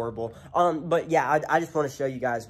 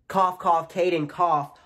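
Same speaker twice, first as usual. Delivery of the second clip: pointed fake cough; 5 semitones higher, 6 dB louder, slower than usual. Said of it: "I was coughing".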